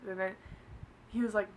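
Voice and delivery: ordinary delivery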